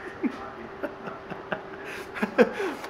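high breathless giggling fit; can't stop laughing; helpless laugh. Real, not acted. Retelling the kid's line, cracking up uncontrollably.

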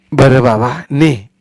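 an indulgent sigh of yes; grandmother giving in. Acted. Grandmother letting Sonya take the money, indulgent.

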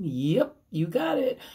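ordinary delivery, nothing marked

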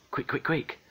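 high little urgent chirps; frantic hurrying. A narrator's reading. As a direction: hurry them along in a high voice. Hurried tidying in story.

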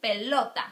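"Pelota" with a loud, stressed middle "lo". Shown as a demonstration. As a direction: punch the LO in "Pelota". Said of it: "It sounds in lota".

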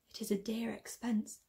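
bitter whispered aside; whispering. Acted. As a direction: breathe it out in a whisper.

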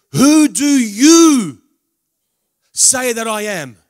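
big slow preaching voice; booming question. Acted. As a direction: proclaim it slowly to the whole hall. Preacher quotes Jesus' question.

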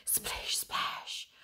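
hushed, voiceless splashy whisper; whispered sound effect. A narrator's reading. Fish twist and splash in the ocean, whispered.

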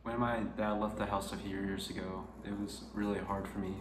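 soft sincere. Real, not acted. Emotional reflection, softer.

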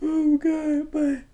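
high, soft exhausted wail; weak squeal after a laughing fit; real laughed-out, squeaky groan; spent from laughing. Real, not acted. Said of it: "My freaking stomach hurt".

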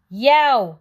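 high, loud yowl of pain; dog's yelp. A narrator's reading. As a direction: yelp as if hit on the head. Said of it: "yelled the dog".

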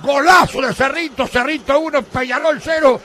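screaming goal call, high and loud; breakneck goal commentary. Real, not acted. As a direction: roar the goal like a radio announcer.

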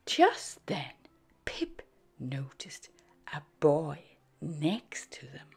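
gentle whispered narration; whispered storytelling. A narrator's reading.

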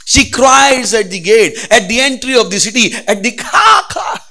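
preaching scripture. Acted. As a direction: cry the verse out like wisdom. Loud scripture proclaiming.

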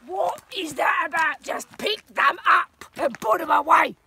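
loud exasperated shout. Pretend. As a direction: yell at her to put the poles away. Shouting at walking companion.

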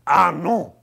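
loud, hammed-up French accent; exaggerated French facilitator. Facilitator answering a grateful woman, comic French accent. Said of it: "because he was French".

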